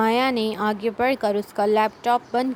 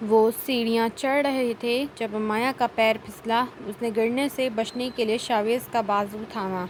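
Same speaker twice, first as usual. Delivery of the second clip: ordinary delivery, nothing marked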